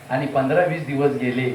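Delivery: ordinary talk, nothing marked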